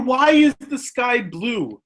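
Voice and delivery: put-on high, slow little-kid question; drawn-out, high-pitched curiosity; curious young child. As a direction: ask with a small child's wonder. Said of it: "the child will turn and say".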